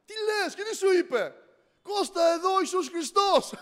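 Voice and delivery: high, loud, eager telling of the news; a brother bursting to share a miracle; excited brother on the phone. Retold or imitated. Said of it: "τον παίρνει τηλέφωνο και του λέει".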